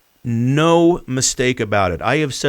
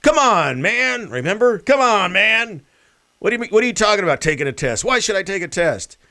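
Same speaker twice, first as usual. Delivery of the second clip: mocking Biden; 9 semitones higher than usual. Imitating Biden's retort.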